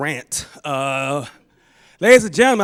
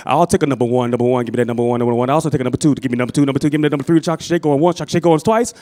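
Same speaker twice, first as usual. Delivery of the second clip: rapid-fire monotone auction chant; auctioneer; 2 semitones lower, much faster than usual. Acted. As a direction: rattle it off like an auctioneer calling bids. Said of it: "he starts sounding like an auctioneer".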